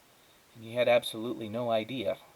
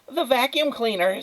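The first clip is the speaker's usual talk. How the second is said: high, louder cry of surprise; startled neighbour; 14 semitones higher, 5 dB louder than usual. Acted. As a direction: neighbour sees the squirrel lift the vacuum, high startled cry. Neighbour spots vacuum overhead.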